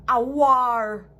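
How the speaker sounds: blurted out loud; emphatic loud punchline; loud declaration. Real, not acted. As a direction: slam it down hard. What a Jamaican man would do.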